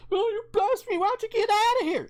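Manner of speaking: high mocking voice of an outraged conservative. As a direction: squeal it like a scandalized prude. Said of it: "any conservatives there are like".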